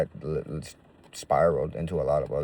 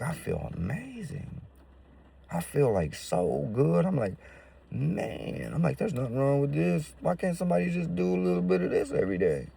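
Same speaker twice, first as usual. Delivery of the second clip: euphoric; 9 semitones higher than usual. Retold or imitated. Describing the high to himself, higher amazed euphoria. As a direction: marvel at how good you feel.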